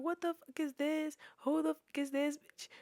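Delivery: mild mock-outraged demand; mock yelling. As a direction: imitate a yell at restrained volume. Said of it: "we're yelling at each other".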